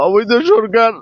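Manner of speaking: ordinary delivery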